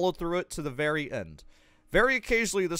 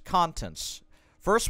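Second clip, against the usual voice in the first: ordinary delivery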